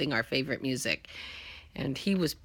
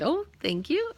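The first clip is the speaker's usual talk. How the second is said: mock offended; 13 semitones higher, 5 dB louder than usual. Mock offence at "you're old.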